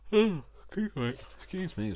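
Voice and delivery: talking through a real yawn; high, loud, stretched words caught in a yawn. Real, not acted. Vlogger excusing herself to viewers mid-video, yawning. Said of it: "Sorry, yawning".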